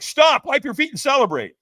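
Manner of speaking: high, loud call; catchers' command. Retold or imitated. Catchers at the lane's end halt a walker, loud retold order. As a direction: call it out urgently. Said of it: "And they're like".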